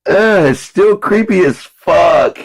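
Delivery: high, loud, slow disgusted whine; creeped out. Retold or imitated. Older self at birthday, high slow creeped-out whine. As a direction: drag it out in horror. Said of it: "I'm like".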